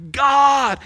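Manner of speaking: loud, high call to God; crying out to God. Acted. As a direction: cry out to God from the depths. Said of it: "He cries out to God".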